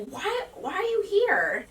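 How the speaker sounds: high-pitched, startled questioning; surprised mom. Retold or imitated. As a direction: imitate mom caught off guard, pitch jumping up. Mom startled by child's arrival.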